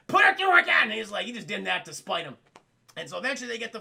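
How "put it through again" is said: loud, high barked command; angry order. Retold or imitated. Warden spitefully ordering the food rescanned, angry shout. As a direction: bark the order out. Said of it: "warden's like".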